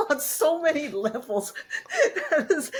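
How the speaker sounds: high-pitched, laughing through the words; amused, breaking into real laughter. Real, not acted. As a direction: laugh as you say it. Cracking up at the story.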